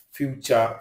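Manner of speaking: ordinary talk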